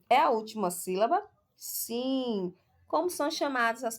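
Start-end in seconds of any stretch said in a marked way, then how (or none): none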